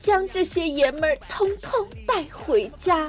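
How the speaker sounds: hyped-up TV sales pitch; over eager infomercial. Pretend. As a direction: sell it like a late-night ad. Mock advert for renting men.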